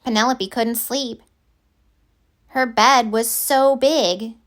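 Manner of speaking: ordinary delivery, nothing marked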